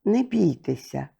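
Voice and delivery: soft, cool reassurance; calm them, cold but kind; soft, cool and gentle voice; cold yet tender. A narrator's reading. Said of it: "голосом, який був і холодний, і лагідний".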